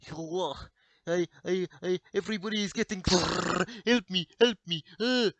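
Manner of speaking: panicked Russian soldier, high and loud; hammed-up Russian soldier under fire; mock Russian soldier. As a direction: cry out in a put-on Russian voice. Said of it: "let's say we're, like, fighting Russia or something".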